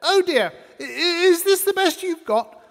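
high, prissy lady customer; high, disdainful matron sniffing at the meat; fussy lady. Retold or imitated. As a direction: pipe it up like a sniffy housewife. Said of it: "She sniffed at it and said".

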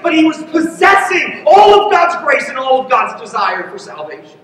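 high-pitched, driving pulpit delivery; impassioned preaching. Real, not acted. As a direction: preach it with mounting conviction, point by point. Preaches at rising force.

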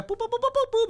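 squeaky high boop boop noises; playful high boops. Acted. Playing the game, squeaky high boops. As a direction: chirp it up like a cartoon. Playful sound while moving.

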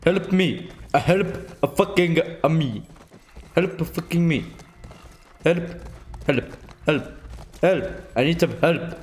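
hammed-up cries of help to the game; mock calls for help. Stuck in game, calling for help.

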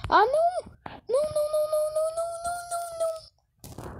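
high panicked no no no; panicked screaming. Real, not acted. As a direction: scream in terror. Zombie chasing him in game.

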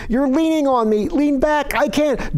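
Jenny crushed under hooves, shouting up at Rennie; strained shouting. A narrator's reading. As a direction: cry out under the weight.

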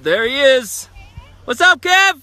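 loud, high greeting shout; calling out. Real, not acted. Friend greeting Kevin as he skis in, loud high call. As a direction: shout up the slope to greet him.

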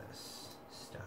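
soft unvoiced whisper. Real, not acted. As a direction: drop to a whisper. Unvoiced whisper mid chat.

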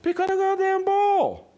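very high strained shout, acted; exasperated yell. Owner orders dog to fetch, high exasperated yell. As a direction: burst out at the dog in mock frustration.

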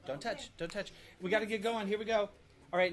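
normal talking voice

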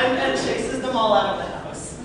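ordinary talk

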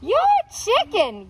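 high mock taunt; taunting yell. A narrator's reading. Noodles yelling at the soup, high jeering taunt. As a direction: jeer it out high. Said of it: "yelled the noodles".

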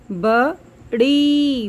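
बड़ी said slowly with the long ई held; slow stretched long ई. Shown as a demonstration. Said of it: "ई की आवाज़ आ रही है". (बड़ी की ई को खींचकर धीरे बोलना)

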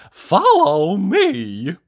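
high, loud, very drawn-out call; Mister Happy. A narrator's reading. Happy visitor leads the miserable one out, loud and high. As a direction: call it out slowly with cheery resolve. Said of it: "Mister Happy made his mind up".

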